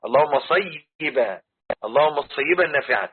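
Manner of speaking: صيبا as a clear "say-yi-ban"; correct pronunciation. Shown as a demonstration. Teacher modelling the prayer for students, careful pronunciation. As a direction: separate the syllables of صيبا, "say-yi-ban". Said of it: "النطق الصحيح".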